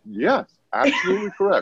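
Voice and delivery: high, put-on smug agreement. Comedian taunting the held-back bro, smug high voice. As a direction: cheerfully rub it in. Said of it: "I was like".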